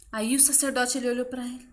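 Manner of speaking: usual speaking voice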